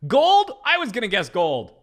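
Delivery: high, loud shocked yelp; shocked gold. Real, not acted. Streamer reacting to the rank reveal, shocked yelp. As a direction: yelp it in disbelief.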